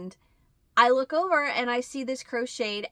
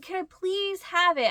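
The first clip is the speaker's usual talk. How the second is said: high pleading; 10 semitones higher than usual. Retold or imitated. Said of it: "I said".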